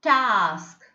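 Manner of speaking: "Task" with a long ah; prolonged ah. Shown as a demonstration. Teacher giving an example word, prolonged ah. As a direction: stretch the vowel in "Task". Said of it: "you have to prolong it".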